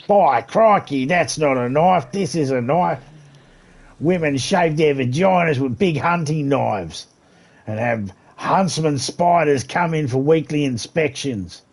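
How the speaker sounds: loud, high, over-the-top Aussie; shouty caricature Australian; Australian accent. Shown as a demonstration. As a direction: ham up a broad Australian outback accent, drawn out. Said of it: "Can you do Australian?".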